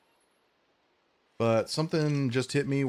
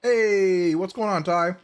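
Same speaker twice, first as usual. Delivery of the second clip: loud greeting; 6 semitones higher, 6 dB louder, slower than usual. Real, not acted. Greeting a friend joining.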